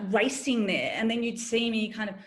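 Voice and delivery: ordinary talk, nothing marked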